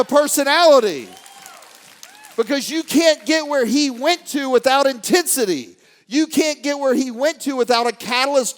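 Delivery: loud, high, forceful preaching delivery; fired-up motivational intensity. Retold or imitated. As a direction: drive each point home at full force. Retells his fired-up demand to Jay.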